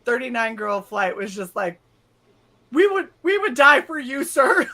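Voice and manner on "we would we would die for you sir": higher, louder earnest pledge; mock fervent vow. Flight pledging loyalty to the instructor, mock fervent vow. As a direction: declare your loyalty with hammed-up passion.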